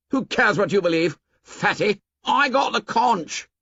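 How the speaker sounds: high, sneering taunt; angry. A narrator's reading. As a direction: snap at the fat boy. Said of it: "unaccountably angry".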